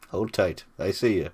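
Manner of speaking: ordinary talk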